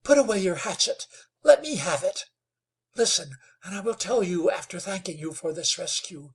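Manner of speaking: put-on a girl pleading for mercy; pleading young woman. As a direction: beg him to listen, urgently. Nell pleading with the hunter.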